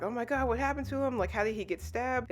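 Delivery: high, fast panicky babble; mock panic. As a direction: rattle it off like lightly acted panic. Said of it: "They're like".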